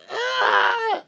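real helpless high cackle; high shrieking laugh. Real, not acted. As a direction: burst into a high squealing laugh. Laughing at reading papers.

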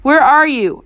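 contained narrator's shout. A narrator's reading. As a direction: shout it down the phone. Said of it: "I shouted".

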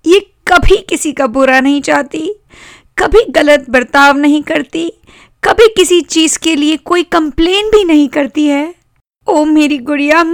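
put-on high-pitched girl pleading after crying; high, plaintive sister voice; tearful little sister. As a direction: defend the doll in a high, upset child's voice.

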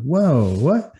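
shocked. Retold or imitated. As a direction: gasp it out in disbelief. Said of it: "I'm like".

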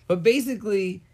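ordinary talk, nothing marked

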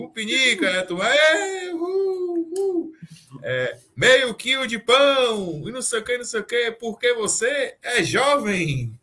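high, slow game show host with mock cry; hammed-up host laughing voice; Silvio Santos impression. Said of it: "imitação do Silvio Santos".

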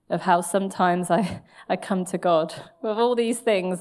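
ordinary talk, nothing marked

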